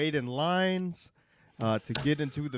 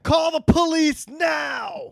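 high, slow drawn-out yell; mock shout; 12 semitones higher, 4 dB louder, much slower than usual. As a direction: bellow it slowly, mocking her. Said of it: "You said".

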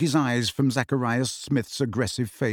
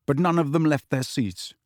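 plain reading voice

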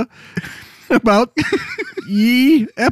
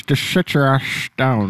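Sean Connery impression; 6 semitones lower, 3 dB quieter than usual. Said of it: "a Sean Connery voice".

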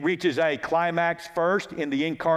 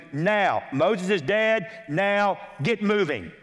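usual speaking voice

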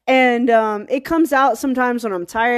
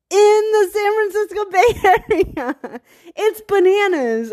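laughing through it; 9 semitones higher than usual. Real, not acted. Laughs at the irony.

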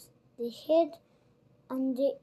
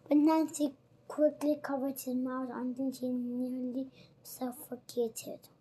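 plain reading voice

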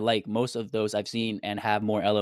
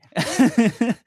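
loud laugh; 13 semitones higher, 9 dB louder than usual. Real, not acted. Burst of laughter at own joke.

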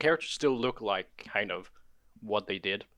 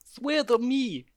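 slow, high hammed-up Batman voice; gravelly Batman; 13 semitones higher, 5 dB louder, much slower than usual. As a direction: drag it out like a parody Batman. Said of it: "with a Russian accent".

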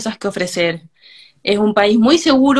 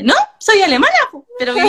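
put-on much higher, louder Chilean-accented reply; 10 semitones higher, 4 dB louder, faster than usual. German friend explaining she's German, imitated in a Chilean accent. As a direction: mimic a German woman's Chilean lilt. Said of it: "Y con el acento chileno".

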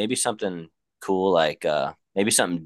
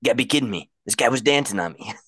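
lightly voiced indignant urchin, higher at first; Surf's Up sea urchin; 4 semitones higher than usual. Quoting the movie urchin to friends, lightly indignant. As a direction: lightly imitate an aggrieved little urchin. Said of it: "The sea urchin, when he's like".

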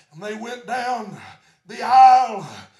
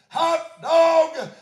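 joyful cry; 4 semitones higher, 5 dB louder than usual. Retold or imitated. Repeats joyful cry to mother.

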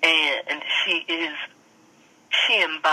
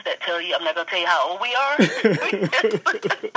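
laughing. Real, not acted. Jokes about her age.

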